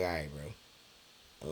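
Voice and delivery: giving up to his mom, slow and soft; resigned. Retold or imitated. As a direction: let it drop, defeated and slow. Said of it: "I was just like".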